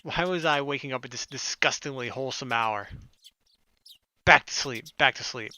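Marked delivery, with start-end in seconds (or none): none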